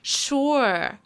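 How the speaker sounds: slow, exaggerated nineties sarcasm; mock-agreeing, drawn-out and dismissive; sarcastic. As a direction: lay the sarcasm on thick, stretching the words. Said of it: "Sarcasm is so nineties, being like".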